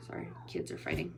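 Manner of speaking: hushed whisper to the audience; whispered aside. Real, not acted. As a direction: drop to a whisper to apologize. Aside to viewers after yelling.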